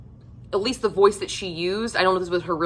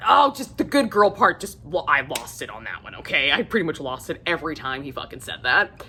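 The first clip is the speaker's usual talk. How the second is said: normal talking voice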